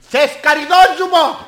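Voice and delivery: high, loud and drawn out; stretched high yell; sudden shouted offer. Acted. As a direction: holler it like a street hawker. Abrupt shouted offer, a running gag.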